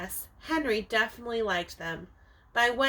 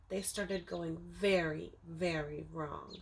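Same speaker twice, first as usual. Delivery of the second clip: ominous hush; 5 semitones lower, 6 dB quieter, slower than usual. Acted. Ominous turn in the story.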